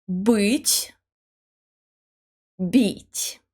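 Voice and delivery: "Быть" with a back ы, then "Бить" with a front и; hard "Быть", soft "Бить", said slowly one after the other. Shown as a demonstration. Teacher repeats the pair so learners hear the hard and the soft b. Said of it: "b is soft".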